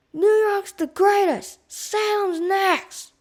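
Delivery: loud high taunt, slow; taunting yell. A narrator's reading. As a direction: jeer to start a fight. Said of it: "A fight started".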